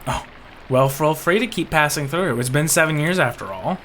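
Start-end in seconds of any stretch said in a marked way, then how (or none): none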